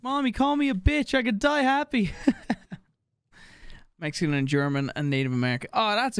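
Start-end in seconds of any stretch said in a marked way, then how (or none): 0.0-2.1 mock giddy kid. Acts thrilled child.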